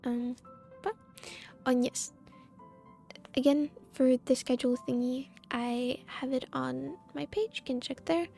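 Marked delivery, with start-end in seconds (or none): none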